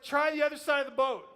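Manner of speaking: high, loud call to a distant boat. Acted. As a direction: holler advice across the water. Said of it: "he's calling out across the water".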